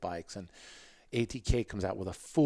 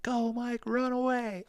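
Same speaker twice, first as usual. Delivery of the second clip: high, loud mock cheer from the stands; retold crowd yell; 5 dB louder, slower than usual. Crowd in the video yelling at Mike, high mock shout. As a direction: yell it like fans urging a rider on. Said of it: "you can hear people yelling".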